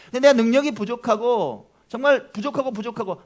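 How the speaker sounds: ordinary delivery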